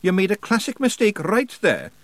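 high Scottish character, chatting casually; easy-going Scots guide voice; conversational Scots. A narrator's reading. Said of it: "said Rob conversationally".

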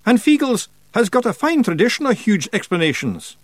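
high, proud boast; swelling with pride. A narrator's reading. Rob boasts of Feegle explanations, proud. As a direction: boast loudly of the clan tradition. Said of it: "swelling with pride".

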